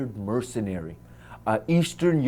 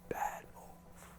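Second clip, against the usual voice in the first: hushed inner voice; whispered thought; 21 dB quieter than usual. Retold or imitated. A whispered note to himself about the rifle. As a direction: breathe it as a private warning.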